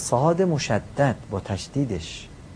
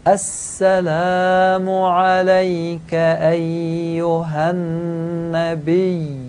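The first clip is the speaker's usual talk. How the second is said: slow chanted السلام علیک ایها النبی, higher and louder; recitation model; 4 semitones higher, 3 dB louder, much slower than usual. Shown as a demonstration. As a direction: recite it slowly for students to repeat. (قرائت آهنگین و کشیده برای تکرار شاگردان)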